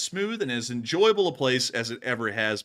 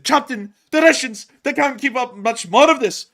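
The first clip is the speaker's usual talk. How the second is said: loud, higher panicked engineer; Scotty impression; 10 semitones higher, 8 dB louder than usual. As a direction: plead like a ship's engineer. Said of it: "just like Matthew said".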